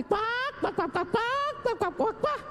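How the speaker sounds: high, soft mock quacking; duck quacking. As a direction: quack like gossiping ducks to mock them. Mocking the wailing mourners' chatter. (imitando patos con burla)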